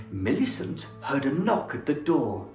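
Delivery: usual speaking voice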